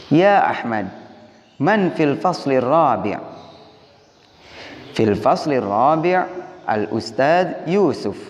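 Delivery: slow, careful Arabic dialogue with clear question rises; Arabic practice dialogue read slowly; model dialogue reading. Shown as a demonstration. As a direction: read each line as a model for the class to copy. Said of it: "bagaimana cara mengucapkannya atau bagaimana intonasinya".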